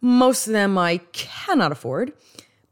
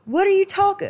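high-pitched, abandoned stab at Southern; badly faked Southern accent, pitched way up; attempted Southern accent; 12 semitones higher than usual. Shown as a demonstration. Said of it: "I can't do a Southern accent".

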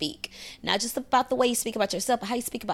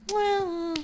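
mock high skeptical well; 10 semitones higher than usual. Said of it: "And then you're like".

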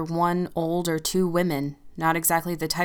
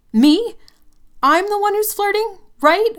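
much higher and much louder, outraged; incredulous scream; 11 dB louder than usual. Acted. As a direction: scream it back in disbelief. She screams incredulously at Tim.

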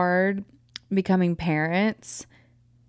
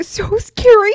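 high loud squeaky fright; mock scared; 14 semitones higher, 10 dB louder, slower than usual.

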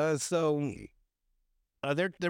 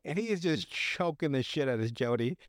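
ordinary talk, nothing marked

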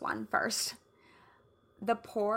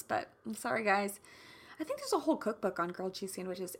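ordinary talk

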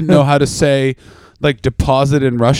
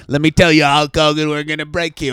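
lightly voiced eighties heel; a touch of villain promo menace; wrestling heel. Wrestling heel threatening Hulk Hogan, lightly played villain. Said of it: "late eighties heel laugh".

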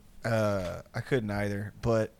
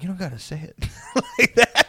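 higher, hurt plea breaking into laughter; mock choked up; 6 semitones higher than usual. To roommates who are cooking him, mock choked up. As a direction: imitate getting choked up, half laughing. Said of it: "kinda getting choked up".

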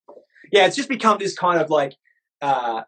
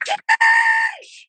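very high drawn-out shouted sheesh; mock viewer shout; 3 dB louder than usual. Mocking viewers who yell the term, very high shout. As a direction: squeal it like a hyped chat. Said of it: "every one of you motherfuckers go".

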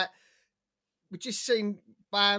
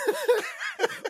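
laughing; 4 dB louder than usual. Real, not acted. Laughs at Kaya joke.

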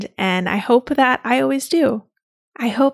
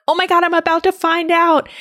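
higher, thrilled rush of anticipation; excited anticipation; 8 semitones higher than usual. Retold or imitated. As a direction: squeal it like the big reveal is seconds away. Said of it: "I was like".

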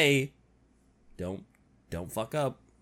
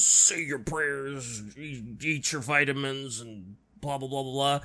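Hulk Hogan voice; 7 semitones lower, 5 dB quieter than usual. Retold or imitated. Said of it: "a Hulk Hogan meme".

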